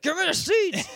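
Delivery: high-pitched cartoon demand; mock villain demand. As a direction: blurt it out like a cartoon character. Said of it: "He's like".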